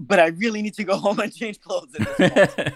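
words breaking into real laughter; amused, giggling through the excuse; laughing while talking. Real, not acted. Telling the tourist she needs to change, laughing.

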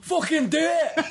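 high, slow shouted Scottish goading; Nikki Cross. Acted. Co-host imitating a wrestler on request, Scottish screech. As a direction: drag out the yell in broad Scottish. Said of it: "Can you do a Scottish accent".

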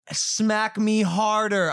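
slow, insistent imitation of her; demanding mock woman. Woman urging a boxer to hit harder, mock demanding.

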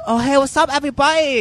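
very high, exaggerated British accent; put-on British accent. Shown as a demonstration. Comic shows co-hosts an obvious accent, exaggerated British. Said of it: "Can we address my accent".

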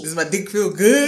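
slow, put-on man asking; imitated man. Acting out a man asking her for feedback, slow mock male voice. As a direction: play a man drawing out his question. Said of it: "What can I say to her?".

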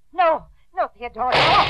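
frightened protest, breaking into a loud cry; alarmed pleading. Acted. Aunt begs her armed nephew to stop, terrified. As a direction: beg him not to shoot.

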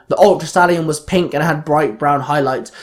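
usual speaking voice

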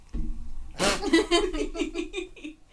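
high, loud burst of giggles; real giggle. Real, not acted. Laughing at co-host's remark, giggly. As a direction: crack up laughing.

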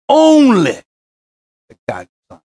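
"only" cried out high and loud, then "begotten son" hushed; a sudden preacher's cry followed by a hush. Acted. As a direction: break upward on "only", then drop to near silence.

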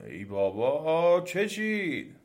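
slow groaning complaint rising high; exasperated demon. A narrator's reading. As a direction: drag it out, fed up, climbing in pitch. Woken demon, fed up with cries.

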